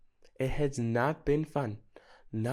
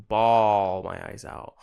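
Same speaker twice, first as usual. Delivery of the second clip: drawn-out, louder mock bawling; mock wail; 5 semitones lower, 7 dB louder, much slower than usual. Vlogger acts out bawling her eyes out, hammed-up wail. Describing how hard she cries.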